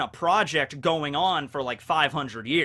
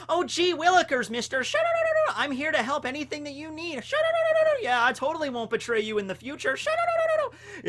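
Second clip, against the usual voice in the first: high squeaky lab assistant voice with giggles; young Caesar voice; 12 semitones higher than usual. Retold or imitated. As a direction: play a goofy eager young assistant. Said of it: "you know".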